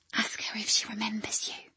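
soft unvoiced whisper; quiet whisper. Ellen to Dougie beside the bed, hushed whisper. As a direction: murmur it so the patient won't hear. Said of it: "she said quietly".

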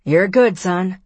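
called out loud and encouraging; calling out. A narrator's reading. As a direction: call it across the beach, loud.

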